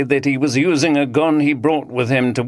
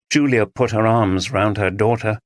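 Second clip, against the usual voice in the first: ordinary delivery, nothing marked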